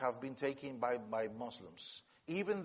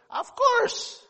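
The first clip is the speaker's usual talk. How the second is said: loud squeaky falsetto, much higher and louder; a cheerful high squeal of a yes; friend's funny voice; 12 dB louder than usual. Retold or imitated. The pastor answering the secret police in his funny voice. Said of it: "his voice is quite funny".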